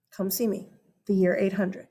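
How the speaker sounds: usual speaking voice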